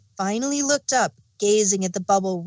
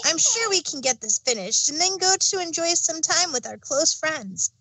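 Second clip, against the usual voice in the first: put-on higher young dragon voice, eager; a cheerful young helper reassuring his friend; Spike voice; 6 semitones higher than usual. Spike reassuring Twilight, higher and eager.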